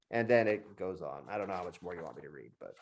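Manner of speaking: ordinary talk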